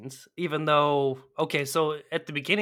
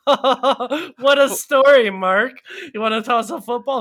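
much higher, louder, laughing cartoonish Johnny; hammed-up Johnny; 9 semitones higher, 10 dB louder than usual. Podcaster mocking Johnny's reply to a tragic story, high and hammed-up. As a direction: ham up an oblivious goofy cheer. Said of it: "Johnny's like".

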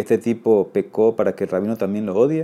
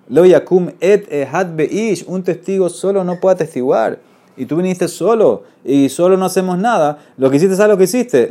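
mock scolding; 8 semitones higher, 5 dB louder than usual. Rav Papa scolds the lone witness.

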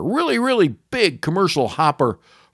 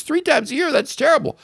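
high, shocked outcry at the news; appalled disbelief; 13 semitones higher than usual. Retold or imitated. Neighbor woman hears the plan and is appalled, high shocked outcry. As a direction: gasp it out as if scandalized. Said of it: "She goes".

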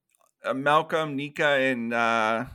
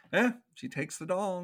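ordinary talk, nothing marked

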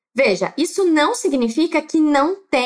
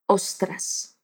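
"ostras" whispered; whispered euphemism; 8 semitones lower, 7 dB quieter than usual. Shown as a demonstration. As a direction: breathe "ostras" out without voice. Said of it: "ele solta outro eufemismo". ("ostras" sussurrado)

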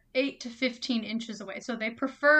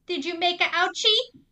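high, loud mock baby-talk voice; 7 semitones higher, 6 dB louder than usual. Parent asking a baby about a boo-boo, exaggerated baby talk. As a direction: coo it up high like talking to an infant. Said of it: "in baby language".

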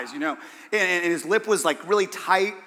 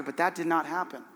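ordinary delivery

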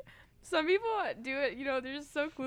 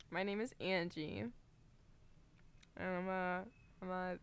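said softer and lower, reluctantly; mumbled reluctant; 8 semitones lower, 12 dB quieter than usual. Shown as a demonstration. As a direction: mumble it like you don't believe it. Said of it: "So you have to say".

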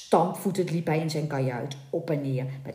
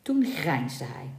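ordinary delivery

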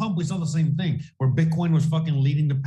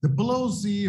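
high, mocking voice of a comedian in disbelief; lightly voiced scoffing comedian; mocking quote; 9 semitones higher than usual. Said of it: "basically he's like".

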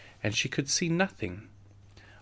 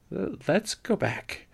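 higher, uneasy suggestion to leave; urgent, hushed; 9 semitones higher, much slower than usual. A narrator's reading. Laura turning Carrie away from the wolf, uneasy urging. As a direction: urge a retreat in a raised pitch. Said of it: "Laura said quickly".

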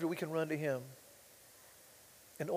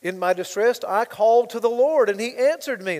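louder, higher prayed line; prayer from the fish; 4 semitones higher, 10 dB louder than usual. Acted. Jonah praying from the fish's belly, loud. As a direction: pray it out aloud. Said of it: "Jonah prayed".

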